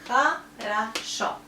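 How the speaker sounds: "Хорошо" slowly split as kha, ra, SHO; slow "Хорошо", A in both unstressed syllables; slow syllable demo. Shown as a demonstration. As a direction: break "Хорошо" into syllables, O only on the last. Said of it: "So we pronounce O like O, only here".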